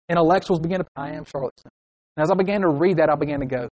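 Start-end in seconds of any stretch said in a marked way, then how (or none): 1.0-1.8 soft, self-satisfied aside; hushed little declaration; murmured self-mantra. Acted. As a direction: say it quietly to yourself. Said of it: "repeat over and over to herself".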